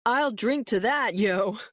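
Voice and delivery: high mock guy voice, badly done; hammy put-on buddy voice; bad imitation of Luis. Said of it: "in a bad imitation of Luis's voice".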